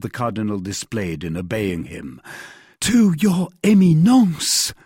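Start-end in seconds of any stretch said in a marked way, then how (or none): 2.8-4.7 high, loud, drawn-out amazement; slow, raised-pitch astonished echo. A narrator's reading. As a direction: echo it in sheer disbelief. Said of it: "murmured Bonacieux, rolling his eyes round in astonishment".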